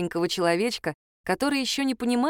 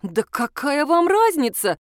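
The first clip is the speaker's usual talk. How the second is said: high, drawn-out exasperated protest; affronted rhetorical question; indignant; 6 semitones higher, 4 dB louder, slower than usual. A narrator's reading. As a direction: push back indignantly, pitched up. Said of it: "возмутилась я".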